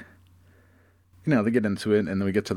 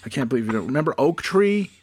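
offended Morris; 6 semitones higher, 3 dB louder, faster than usual. Acted.

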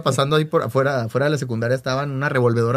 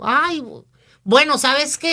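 higher fussy mother voice; lightly voiced flustered mother; imitating a mom; 11 semitones higher, much slower than usual. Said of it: "La mamá dijo".

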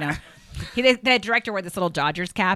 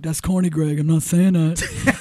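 imitating Shaq; 7 dB louder, slower than usual. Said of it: "he'd be like".

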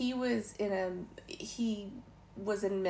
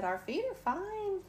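bright high reply; 11 semitones higher than usual. Retold or imitated. Cheerful reply to preacher.

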